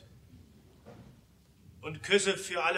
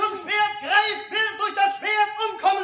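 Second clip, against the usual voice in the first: high, fast shouted proverb; shouted warning; 5 dB louder than usual. Acted. Delegate warning opponents across the hall, shouting. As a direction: fling the proverb back at them. Heated commune debate.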